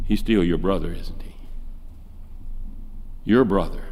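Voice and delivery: a touch of fatherly softness on "Your brother"; gentle correction. Acted. Father gently reminding his son that the prodigal is his brother. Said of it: "and his dad says".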